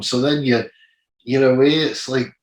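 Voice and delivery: ordinary talk, nothing marked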